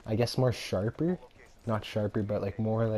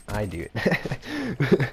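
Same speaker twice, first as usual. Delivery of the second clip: sly confession; 3 semitones higher, 5 dB louder, much slower than usual. Real, not acted. Cheeky admission after disclaimer.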